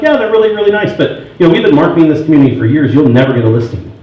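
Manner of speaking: high imitated husband, quickening into dismissal; Troy. Rival agent husband dismissing her marketing, imitated high voice. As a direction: start high and placating, then drop into a brush-off. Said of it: "He's like".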